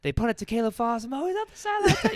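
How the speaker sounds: rising, hyped play-by-play call, pitch climbing high; pretend sports announcer. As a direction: call your own punt return like a TV announcer in the shower. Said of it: "I'm pretending to be the, uh, the announcer".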